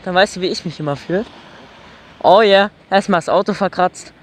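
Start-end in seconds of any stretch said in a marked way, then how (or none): none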